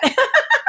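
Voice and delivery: high bright laugh; burst of laughter. Real, not acted. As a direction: laugh at yourself. Laughs at her own remark.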